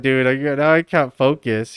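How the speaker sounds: ordinary delivery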